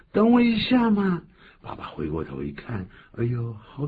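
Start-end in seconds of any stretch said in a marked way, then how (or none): 0.1-1.2 put-on high childish plea; child pleading. Teacher voicing the son begging dad to wait, high and childish. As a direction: whine it like a little boy.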